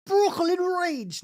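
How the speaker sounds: high, slow Brooklyn holler; Brooklyn accent. Pretend. Streamer yelling a silly catchphrase in a Brooklyn accent. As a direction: stretch "Brooklyn Rage!" out like a hammy New Yorker. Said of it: "That's fun to do that".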